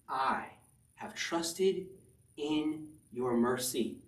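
very slow, drawn-out words of trust; slow reading. Acted. Pastor reading David's psalm to the church, very slow. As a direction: let each word of trust land slowly.

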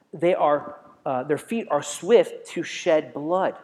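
slow and loud; slow, pressing recitation; scripture reading. Acted. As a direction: weigh every word of the verse. Rereading verse slowly.

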